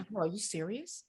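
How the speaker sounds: soft-spoken disbelief, well under normal volume; Nicola. Retold or imitated. Soft-spoken friend questioning the news, quiet disbelief. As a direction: ask it softly, as a quiet friend would. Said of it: "she's so soft-spoken".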